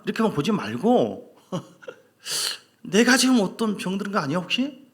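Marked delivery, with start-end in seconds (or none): 2.8-4.8 higher, louder worried self-check; self-questioning believer. Retold or imitated. Preacher voices a believer suddenly doubting himself, alarmed. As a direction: ask yourself in sudden alarm. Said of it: "이렇게 생각해 보셔야 돼요".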